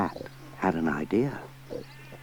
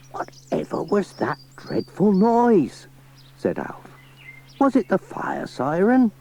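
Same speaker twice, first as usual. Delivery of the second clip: farmer voice; 10 semitones higher than usual. Acted. Farmer Alf alarmed.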